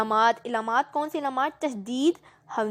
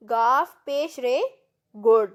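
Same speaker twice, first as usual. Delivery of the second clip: slow spelling; slower than usual. Shown as a demonstration. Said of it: "گڑیا کو ہم کیسے؟".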